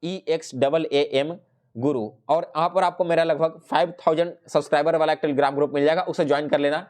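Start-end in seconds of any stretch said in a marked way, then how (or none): 0.0-2.1 ईएक्सएएएम Guru spelled letter by letter, very slow; spelling it out. Shown as a demonstration. To viewers searching Telegram, slow letter-by-letter spelling. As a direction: slowly spell out each letter of ईएक्सएएएम so viewers can type it. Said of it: "direct Telegram में जाकर search करना".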